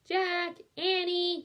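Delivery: high, loud call from afar; distant cry. A narrator's reading. Mom calling the kids again from far off. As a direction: call their names loud across the woods. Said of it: "came the distant cry again".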